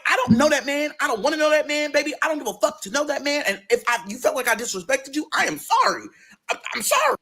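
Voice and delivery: high-pitched, insistent denial; exasperated defence. Retold or imitated. Girlfriend defending herself to her boyfriend, exasperated. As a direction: protest climbing to a very high apology.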